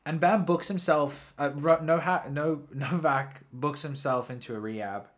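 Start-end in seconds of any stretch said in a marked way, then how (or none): none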